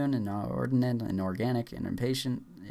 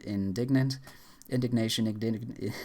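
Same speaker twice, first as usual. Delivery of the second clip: usual speaking voice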